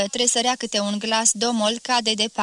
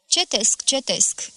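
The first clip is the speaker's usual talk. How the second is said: usual speaking voice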